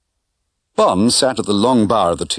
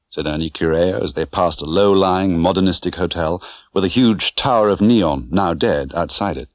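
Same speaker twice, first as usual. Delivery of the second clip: ordinary delivery, nothing marked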